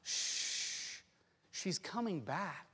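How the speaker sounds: soft, slow soothing hush; whispered reassurance, drawn out; comforting whisper. Acted. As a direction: hush a crying child gently. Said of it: "they will whisper these words".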